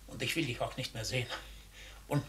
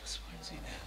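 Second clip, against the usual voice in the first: annoyed murmur; 8 semitones lower, 13 dB quieter than usual. Acted. Comedian snaps at an interruption.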